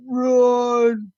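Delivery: high, stretched-out distant-style holler; mock sergeant's urgent call; sergeant urging. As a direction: holler it long, as if across the woods. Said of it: "another one of my sergeants in the woods jumping".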